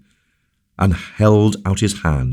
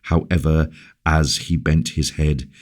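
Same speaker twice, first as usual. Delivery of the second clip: ordinary delivery, nothing marked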